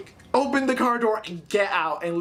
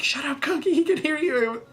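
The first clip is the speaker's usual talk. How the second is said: lightly voiced friend, pitched up, hissing a hush; mock hushing friend; 9 semitones higher than usual. Friend scolding her beside the sleeping guy, lightly voiced. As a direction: imitate a friend telling you to shut up.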